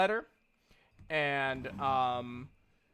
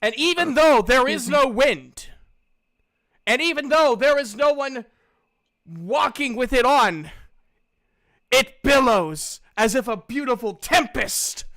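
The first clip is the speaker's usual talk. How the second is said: louder, dramatic scene-setting; grand narration; 6 semitones higher, 8 dB louder than usual. Acted. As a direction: describe the cloak with awe and grandeur. GM's grand description of a magic item.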